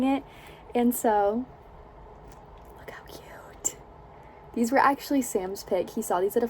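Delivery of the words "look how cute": whispering. Real, not acted. Showing baby shoes.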